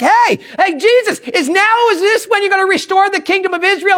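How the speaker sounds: high, eager disciple imitation; eager questioning. Disciples asking Jesus about restoring the kingdom, eager. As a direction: press Jesus with an excited question.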